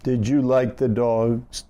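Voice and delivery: usual speaking voice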